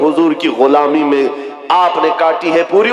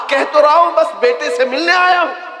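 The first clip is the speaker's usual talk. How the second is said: defensive insistence; 10 semitones higher than usual. Acted. Umair repeats his lie.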